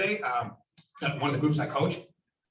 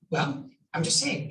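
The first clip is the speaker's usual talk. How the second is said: ordinary talk, nothing marked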